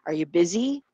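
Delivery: "Are you" hushed, "busy" stands out rising; near-whisper "Are you", then stress "busy"; soft words, stressed BUSY. Shown as a demonstration. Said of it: "some words are softer or mahina".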